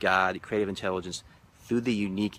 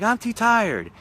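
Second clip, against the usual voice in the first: much higher, louder mock whine; tired refusal; 13 semitones higher, 7 dB louder, slower than usual. Gentleman refusing his partner, loud mock whine. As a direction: whine it, exasperated. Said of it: "And he's like".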